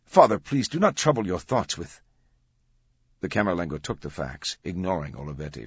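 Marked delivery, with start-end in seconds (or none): 0.0-2.0 high, loud, urgent objection; trying to intervene. A narrator's reading. Commander stopping the priest reading a fax, intervening. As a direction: cut in to head him off. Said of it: "trying to intervene".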